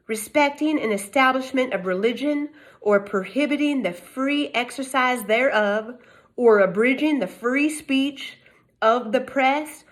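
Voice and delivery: usual speaking voice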